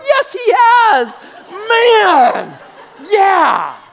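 loud, high, drawn-out hammed-up joy; elated shout. Believer answering a coffee shop blessing, wildly elated. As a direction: crow it slowly at the top of the voice.

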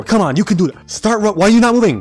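high, loud, exasperated yelling at the screen; frustrated yelling. Real, not acted. Yelling at Coraline to run, exasperated shout. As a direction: yell at her to move, losing it.

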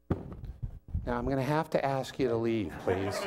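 low, soft, too-polite request; mock-mild Jesus. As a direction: ask meekly, keeping absurdly calm. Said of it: "I'm pretty calm".

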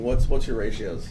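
normal talking voice

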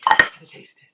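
eager whispered repeat; breathless whisper to herself. Real, not acted. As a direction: hush it like a secret craving.

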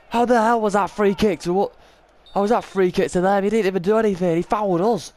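high, loud indignant ranting; outraged protest. Real, not acted. Gamer protesting a free kick given against his team, outraged yelling. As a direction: demand an explanation from the ref, furious.

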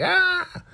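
very high imitated shriek; mock pain scream. Elder mother hit with hot oil, mock shriek. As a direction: give a short, high, play-acted scream.

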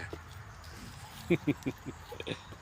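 quiet, breathy laugh; soft chuckle, mostly breath. Real, not acted. Gardener amused by what he finds, quiet chuckle.

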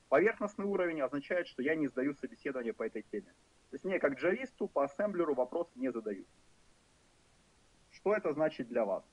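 ordinary delivery

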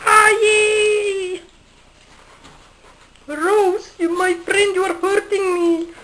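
high squeaky monkey voice, in pain; hurt toy monkey. Pretend. Monkey being squeezed by child.

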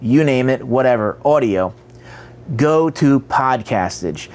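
ordinary delivery

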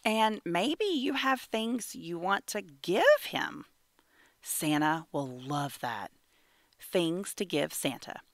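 ordinary delivery

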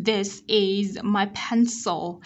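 slow, careful "this is my pencil"; teacher models th, s and z in "this is my pencil"; model reading. Shown as a demonstration. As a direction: draw out "this is my pencil" word by word. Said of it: "T H chúng ta cũng đẩy lưỡi".

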